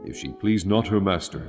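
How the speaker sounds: ordinary talk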